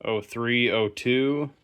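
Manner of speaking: ordinary talk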